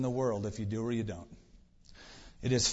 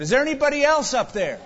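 high, loud, quick panicked shout; hammed-up holler for another rescuer; crying out; 10 semitones higher, 7 dB louder, faster than usual. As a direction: call out in comic desperation. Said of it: "the man cried out".

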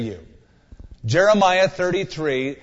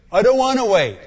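higher, louder whining protest; whiny complaint; 6 semitones higher, 5 dB louder than usual. Acted. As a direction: whine it like an impatient child. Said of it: "whining reply to God's answer".

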